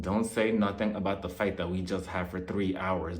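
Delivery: low, hushed, urgent warning; secretive warning. Retold or imitated. Partner warns Louis about the camera, secretive and hushed. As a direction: keep it low and under the radar.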